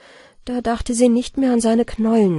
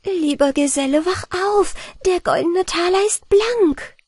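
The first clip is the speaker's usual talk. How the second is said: higher, bright call to wake him; jubilant; 10 semitones higher than usual. A narrator's reading. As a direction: cry out the good news with joy. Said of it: "Jubelnd rief Anna Barbara".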